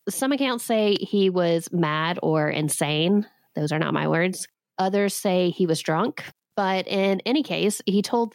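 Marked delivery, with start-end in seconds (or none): none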